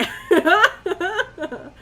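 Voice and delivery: high, loud giggling burst; real laughter. Real, not acted. Laughs at own remark.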